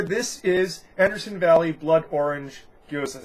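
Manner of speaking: ordinary talk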